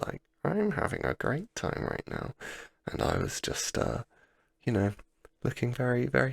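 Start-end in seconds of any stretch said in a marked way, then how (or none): 0.4-2.3 very high-pitched put-on enjoyment; squeaky-high, mock-happy photo voice; wide-eyed mock delight. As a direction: imitate a friend having a forced great time. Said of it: "wide-eyed".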